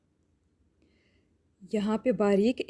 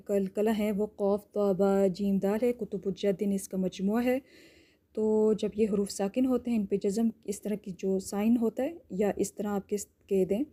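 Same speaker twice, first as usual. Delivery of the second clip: usual speaking voice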